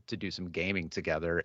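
ordinary talk, nothing marked

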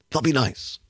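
dropped, understated echo of their hope; wry echo. Real, not acted. Host repeats the hope, doubting it.

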